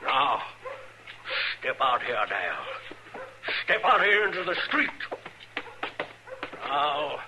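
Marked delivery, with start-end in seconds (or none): 3.6-4.9 fast, rising barked order; angry command. Acted. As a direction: drive the boy out into the street. Father orders son outside.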